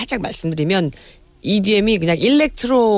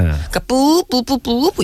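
voiced repeating EDM beat; imitated repetitive beat; 4 semitones higher, slower than usual. Shown as a demonstration. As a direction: mimic a looping synth hit. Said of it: "이게 계속 나와".

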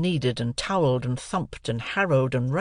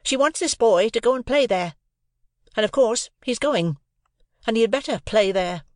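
bossy sister; 9 semitones higher than usual. A narrator's reading. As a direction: lay down the law sharply. Sister orders loudly.

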